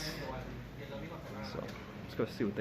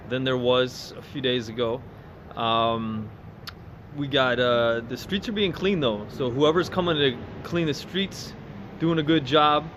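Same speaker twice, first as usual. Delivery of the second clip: raised voice over traffic noise; loud street voice; 5 semitones higher, 9 dB louder than usual. Real, not acted. Talking over busy street noise.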